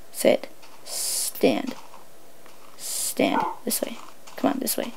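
hushed, breathy dog commands; whispered commands. Real, not acted. To the dog in the game, whispered commands. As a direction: whisper the orders to the pet.

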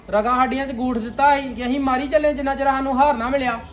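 ordinary talk, nothing marked

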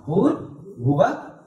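slow Bhur, Bhuva with breathed BH; aspirated H. Shown as a demonstration. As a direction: draw out Bhur, Bhuva, sounding the H. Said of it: "with that H sound".

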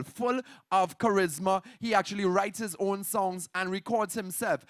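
ordinary delivery

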